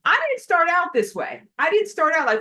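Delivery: exasperated, high and loud; sassy attitude. Acted. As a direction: protest with full human attitude. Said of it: "me with my human attitude".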